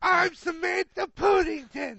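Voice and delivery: hammed-up Cockney ghost lady; mock Cockney accent. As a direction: ham up a Cockney drunk announcing herself. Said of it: "You made her Australian".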